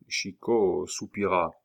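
usual speaking voice

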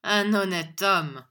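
slow, loud scornful echo; loud, drawn-out sneer; sneering cry. A narrator's reading. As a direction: drag out the words in derision. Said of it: "cria Squeers en ricanant".

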